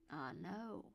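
ordinary delivery

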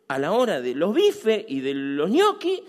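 usual speaking voice

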